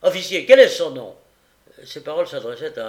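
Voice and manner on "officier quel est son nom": very loud, high bark; peremptory magistrate; imperious demand. A narrator's reading. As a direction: fire the question at the officer. Magistrate demands answer from officer.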